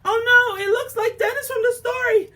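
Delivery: loud, high cry of alarm. Real, not acted. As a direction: sound the alarm, startled. Dragon appears, alarm.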